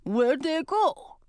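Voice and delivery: slow, high troll voice. Acted. Troll who lost the invisible pair, slow puzzled troll voice.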